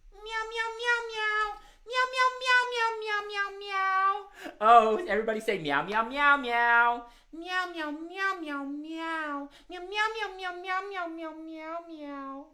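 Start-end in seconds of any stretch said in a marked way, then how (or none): 0.1-4.5 very high kitty puppet meows; cat meowing. Pretend. 5.6-7.0 meowing along. Shown as a demonstration. Host leads children in meowing. 7.3-12.5 high-pitched run of puppet meows; cat meowing. Pretend.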